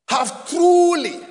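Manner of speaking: truly shouted high and slow; stress on truly. Shown as a demonstration. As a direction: hammer the word truly. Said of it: "emphasis on the word".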